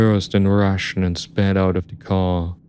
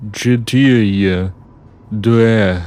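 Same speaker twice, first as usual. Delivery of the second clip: slow, louder Russian counting; stern countdown; 2 semitones higher, 5 dB louder, much slower than usual. Acted. Driver counting down at the narrator, stern. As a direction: count down as a threat.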